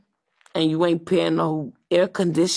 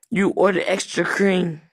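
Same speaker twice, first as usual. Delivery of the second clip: ordinary talk, nothing marked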